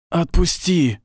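сдавленный хриплый вскрик, высоко; прохрипи с мольбой, срываясь вверх; хрипя. A narrator's reading. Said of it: "прохрипел Нейт". (прохрипеть)

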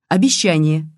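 sudden, slightly forceful interjection; blurted out. A narrator's reading. Detective cutting off the girl's words, blurting. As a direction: blurt the word to cut in. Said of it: "выпалил Бабкин".

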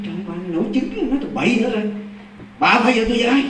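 high-pitched retold scolding, starting under the breath; exasperated husband. Husband telling wife she is talking nonsense, exasperated. Acted husband dismissing wife's odd talk.

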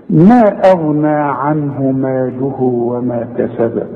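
long slow chant, single ب of كسب lightly bounced; light qalqalah. Shown as a demonstration. Teacher saying listen to the second ba, light bounce on كسب. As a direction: end كسب on a small echoing b. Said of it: "اسمع بقى البه التانية دي".

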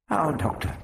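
put-on high old man's query; Prapillus, curious. Prapillus asks the Doctor about his plan, curious. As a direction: ask it lightly, pitched high.

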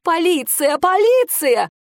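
high loud yell, rising; calling for help. A narrator's reading. Aunt screams for police across the house.

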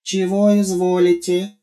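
high, lisping, servile robot. Acted. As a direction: lisp it like an eager servant robot. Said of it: "продолжал шепелявить новый Гоша".

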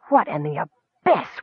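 a low stunned whisper. A narrator's reading. Captain hushed in shock at the kender. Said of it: "whispered the captain".

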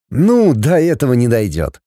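ordinary delivery

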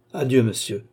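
ordinary delivery

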